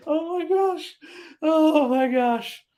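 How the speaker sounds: high, slow, laughing through the words; real giggly disbelief. Real, not acted. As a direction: gasp it out while still cracking up. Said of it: "I'm laughing so much".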